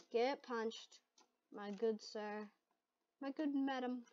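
put-on soft, polite British accent; understated put-on British accent; mock British. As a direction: play a courteous British gent, quietly. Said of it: "saying this in British".

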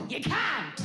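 sudden high-pitched outburst; mock exclamation. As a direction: burst out with the answer, high-pitched. Punchline answer, mock exclamation.

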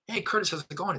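high-pitched phone hello, as to a friend; familiar greeting. Shown as a demonstration. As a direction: open the call as if you know him. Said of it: "say it that way to breed familiarity".

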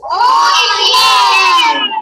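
loud cheer with nose held shut; nose pinched, shouting. Shown as a demonstration. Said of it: "con la nariz tapada".

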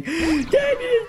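put-on very high child voice; high pleading kid voice; crying little girl. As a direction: squeal it like a tearful little girl. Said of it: "she's going".

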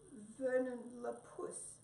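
slow, trembling, drawn-out name; shyly tremble out a grand made-up name; stray cat giving a fake name to Mouse, trembling; low, trembling. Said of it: "in a low, trembling voice".

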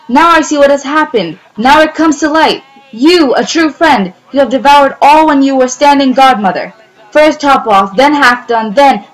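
high, loud, indignant accusation; accusing. A narrator's reading. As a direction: accuse a false friend. Mouse accusing the cat.

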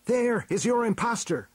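very high, urgent hero cry; high-pitched heroic outburst. A narrator's reading. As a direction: hurl the accusation back. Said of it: "cried He-Man".

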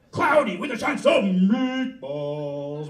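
grand title card, slow and loud; trailer title announce. Acted.

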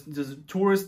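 ordinary talk

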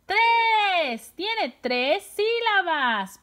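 high, loud, stretched "Tres"; excited answer. Shown as a demonstration. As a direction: celebrate the answer for young pupils. Teacher cheers the counted answer.

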